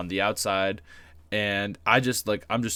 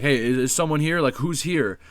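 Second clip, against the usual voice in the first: loud call; 6 semitones higher, 6 dB louder than usual. Retold or imitated. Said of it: "the loud voice being like".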